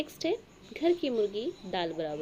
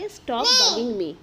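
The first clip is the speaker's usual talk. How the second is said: annoyed; 3 semitones higher, 11 dB louder, much slower than usual. Shown as a demonstration. Annoyed retort in lesson example.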